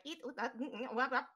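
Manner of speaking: mechanical whirring. Acted. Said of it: "very mechanical whirring".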